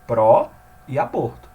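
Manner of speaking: "Pró e aborto" slow and loud, with "Pró" and "aborto" as two separate stressed words; "Pró" and "aborto" set apart as two stressed units; split into two words. Shown as a demonstration. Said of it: "São dois vocábulos fonológicos aqui".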